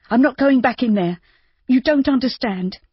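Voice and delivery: pleading and refusing at once; Judy, frantic. A narrator's reading. Judy refusing Rob, frantic and high.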